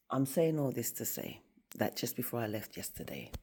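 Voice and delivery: ordinary talk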